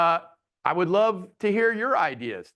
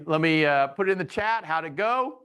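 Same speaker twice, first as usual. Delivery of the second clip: ordinary talk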